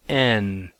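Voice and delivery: "N." said as plain "en", no h before it; the vowel starts clean, with no breath before "N."; smooth-breathing sound. Shown as a demonstration. Teacher showing students the smooth breathing: "N." with no h. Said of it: "it would be pronounced".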